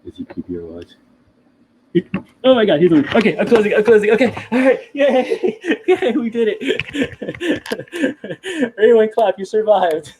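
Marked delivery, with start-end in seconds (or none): 1.9-6.6 high, loud alarm breaking into laughter; real panicked excitement. Real, not acted. Host closing the lid on the woken creature, giddy panic. 6.7-8.7 very high squealing laughter; long breathless-high giggle of relief; relieved giggle. Real, not acted. Host after getting the lid shut, relieved giggle. 8.8-10.1 high, loud relieved cheer; giddy celebration. Real, not acted. Host congratulating viewers after the scare, giddy relief.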